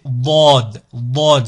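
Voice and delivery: Dod with a heavy, dark emphatic D; firm backed D on Dod; emphatic, grave D. Shown as a demonstration. Teacher demonstrating the emphatic letter Dod, heavy and dark. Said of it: "C'est la même chose, mais avec le D".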